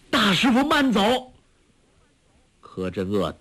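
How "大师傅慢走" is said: high, urgent call after someone. A narrator's reading. Disciple runs after his departing master, calling out. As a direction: cry out high to stop him. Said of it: "叫道".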